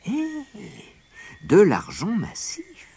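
high, slow, drawn-out marvelling; exclaiming. A narrator's reading. Hearing that the moon is solid silver, exclaiming. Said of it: "s'exclama-t-elle".

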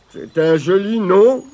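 glum stranger's put-on voice; mumbling stranger. A narrator's reading. Stranger answering Tirondin's introduction, mumbling glumly. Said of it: "marmonna l'inconnu".

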